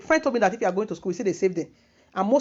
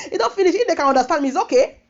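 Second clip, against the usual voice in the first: laughing through words; 7 semitones higher, 6 dB louder than usual. Real, not acted. Still laughing at story.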